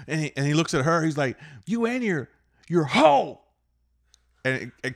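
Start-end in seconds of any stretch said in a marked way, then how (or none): 1.7-3.4 high jeer, rising to a loud last word; mock yelling. Old driver insulting the girlfriend, mock yell. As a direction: imitate an insult hurled between cars. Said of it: "He's yelling".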